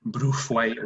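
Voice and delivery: Bruthwaite with an oo-like first vowel; Bruthwaite, deliberately wrong first vowel U; made-up pronunciation. Shown as a demonstration. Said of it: "whatever the fuck you want".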